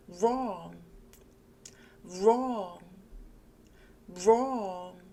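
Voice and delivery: "Wrong" with an R start, open 어, tongue touching nothing; model pronunciation. Shown as a demonstration. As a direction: say "Wrong" as rong, mouth wide. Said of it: "저와 함께 이 단어 읽어보실게요". (입을 크게 벌리고 "wrong"을 롱처럼)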